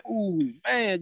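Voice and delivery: a high, delighted coo of joy; delighted ooh. Retold or imitated. Player in the locker room, thrilled with new gear, high delighted coo. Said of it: "I'm pretty happy".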